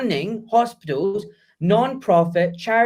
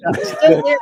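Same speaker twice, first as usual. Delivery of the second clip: high, loud mock insistence that the hair's still there; hammed-up squeaky denial; insisting balding guy; 11 semitones higher, 7 dB louder than usual. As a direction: squeak it out like a man in denial about going bald. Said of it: "we all went through that phase where we're like".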